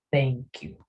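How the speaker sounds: "Thank you" with TH as a Spanish Z, tongue at the upper teeth; soft th in thank. Shown as a demonstration. Teacher modelling "Thank you" with the tongue on the teeth. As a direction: start "Thank" with a lisped th, not a T. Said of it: "sonido de T H".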